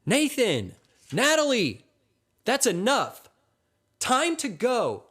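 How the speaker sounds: high, loud scolding mom; mother yelling. A narrator's reading. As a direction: holler at the kids to get out. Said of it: "Nathan's mother appears and yells".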